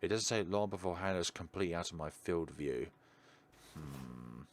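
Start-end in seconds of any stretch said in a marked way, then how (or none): none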